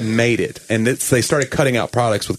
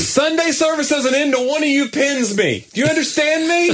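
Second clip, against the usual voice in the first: ranting pastor. Acted. Pastor daring the congregation to pin him, high mock shout. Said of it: "how many pastors that's what Sunday is for them".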